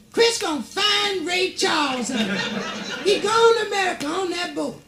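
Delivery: high, slow excited squeal of the queen; screaming queen. Acted. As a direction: run the halls shrieking with joy, pitched high. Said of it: "running through the halls... screaming".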